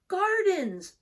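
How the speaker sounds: a squeaky small creature calling out; high Snail voice. Acted.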